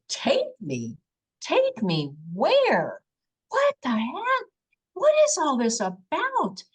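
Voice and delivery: high-pitched, disbelieving mock outburst; incredulous retort. Abductee questions the alien being, acted-out incredulity. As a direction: act out alarmed disbelief, pitch leaping up. Retelling her shocked reply to the being.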